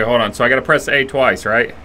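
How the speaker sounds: ordinary talk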